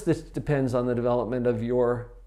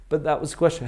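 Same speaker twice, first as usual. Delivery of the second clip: ordinary delivery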